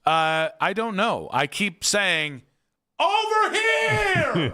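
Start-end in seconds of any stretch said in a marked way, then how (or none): 3.0-4.5 high, slow, drawn-out call across a distance; hollering for wealth to come find him; mock calling out. Calling wealth over from far away, high and drawn out.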